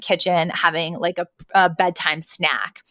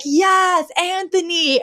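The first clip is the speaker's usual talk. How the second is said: much higher, thrilled "yes"; delighted agreement; 10 semitones higher, much slower than usual. Retold or imitated. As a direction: cheer it like he just got it right. Both women agree with the sergeant.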